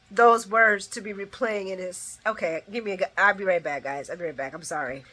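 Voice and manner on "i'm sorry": ordinary talk, nothing marked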